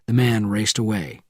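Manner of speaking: plain reading voice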